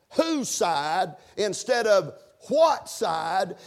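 "whose" and "what" each stretched, with pauses; slow preacher's contrast, leaning on "whose" and "what"; stress on WHOSE and WHAT. Shown as a demonstration. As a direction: set "whose" against "what", slowly. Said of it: "I emphasize the word whose".

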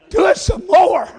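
loud, shouted urging in a preacher's voice; God urging worshipers on. Acted. Preacher voicing God's answer to praise, shouted urging. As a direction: shout encouragement as God might. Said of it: "It's like God saying".

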